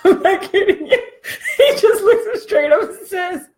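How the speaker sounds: high-pitched, talking through laughter; laughing while talking. Real, not acted. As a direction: tell it while still cracking up.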